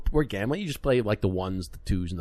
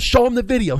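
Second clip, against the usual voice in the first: much higher and louder, eager; excited call; 12 semitones higher, 6 dB louder than usual. Retold or imitated. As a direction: call it out, thrilled to show off. Dealer calling to manager.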